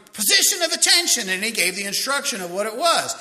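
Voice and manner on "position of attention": yelled command. Retold or imitated. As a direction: call it across the parade ground. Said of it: "they kept yelling".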